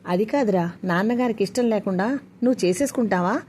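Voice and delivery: ordinary delivery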